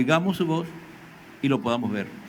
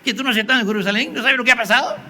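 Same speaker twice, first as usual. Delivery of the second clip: indignant paraphrase; 7 semitones higher, 3 dB louder, faster than usual. Retold or imitated. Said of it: "le dice".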